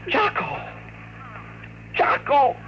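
high, urgent calling of the name; alarmed call. Real, not acted. Owner calling out to his dog caught in the trap, alarmed.

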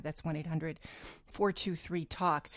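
usual speaking voice

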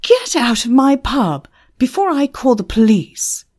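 loud, high threat; shouting, voice breaking. A narrator's reading. Manager threatening Emma with police, strained shout. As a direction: shout the warning at the edge of control. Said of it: "his voice breaking a little".

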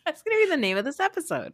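fast, high, laughing delivery. Real, not acted. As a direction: giggle through the line. Delighted by the episode title.

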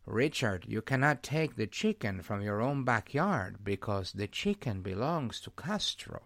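low, slow imitation of an older Cuban professor; Roland Alum voice. Host imitates his professor friend recounting Cuba, low and slow. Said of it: "I'm gonna do my Roland Alum voice".

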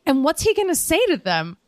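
mock outrage at the plan; incredulous. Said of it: "And I was like".

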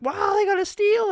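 mock hype, high and loud; excited. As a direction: gush like it's a bargain. Everyone hyping a signing.

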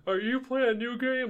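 high-pitched wife voice; imitating curious wife. Wife's question voiced in story.

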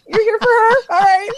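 high, loud reply through laughter; laughing embarrassment. Retold or imitated. Athlete realizes girl wants her teammate, laughing high and loud. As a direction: laugh off the mock humiliation.

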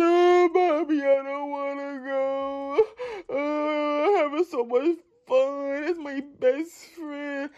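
slow whining little daughter; whiny child. Retold or imitated. Daughter begging mom in the car, slow childish whine. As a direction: whine like a kid who won't leave. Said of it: "she like".

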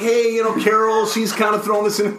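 high pleading voice; high sheepish pleading voice. Retold or imitated. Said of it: "and I'm like".

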